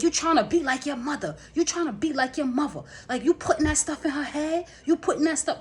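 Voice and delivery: high, loud imitated scolding; accusing. Sister scolding the daughter about her mother, accusing. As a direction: mimic a sister hurling accusations.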